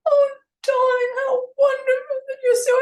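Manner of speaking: high, loud, crying darling voice; gushing old actress. Retold or imitated. Delighted starlet gushing at the writer, high and loud. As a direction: gush like a grand old starlet. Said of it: "And she said".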